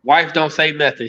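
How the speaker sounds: usual speaking voice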